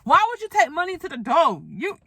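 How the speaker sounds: what on earth are you doing; incredulous outrage. Retold or imitated. Her own outraged reaction retold.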